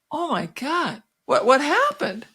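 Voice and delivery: high-pitched imitated shock; retold amazement, pitched well up; astonished reaction. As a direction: act out a stunned double take.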